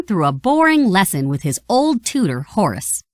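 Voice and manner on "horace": ordinary delivery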